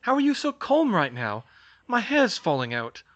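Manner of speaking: high, loud, panicky protest. A narrator's reading. Georgina is losing her hair, protesting loudly. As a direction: cry out in alarm at the calm one. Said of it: "Georgina protested".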